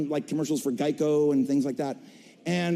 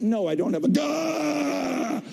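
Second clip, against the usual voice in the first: groggy denial; 8 semitones higher, slower than usual. Retold or imitated. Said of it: "I'm like".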